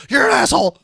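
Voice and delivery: loud absurd outburst; shouting. Shown as a demonstration. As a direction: blurt it out loud. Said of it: "stop someone else's sneeze with an absurd comment".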